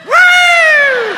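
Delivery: very high, loud cheer; punchline victory whoop; triumphant whoop. Real, not acted. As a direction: whoop like you just won.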